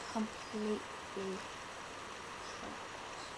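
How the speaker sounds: soft, fading to a whisper; whispered dread. A narrator's reading. Narrator whispers that the lion is trapped. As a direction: let it sink to a whisper. Trap closes.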